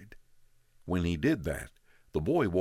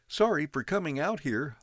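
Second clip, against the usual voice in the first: put-on high young boy's voice, a little shaky; nervous kid apologizing at the door; boy, voice shaking a little; 13 semitones higher than usual. A narrator's reading. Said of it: "his voice shaking a little".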